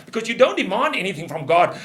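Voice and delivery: ordinary delivery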